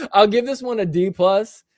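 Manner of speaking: giggling through the verdict; laughing. Real, not acted. Streamer repeats his grade to chat, laughing.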